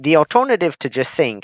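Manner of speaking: ordinary delivery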